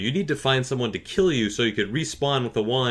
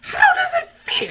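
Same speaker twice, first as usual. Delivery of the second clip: very high, much louder, slow squeal; gloating kid; 10 dB louder, slower than usual. Retold or imitated. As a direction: rub it in like a shrieking kid. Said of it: "And he's like".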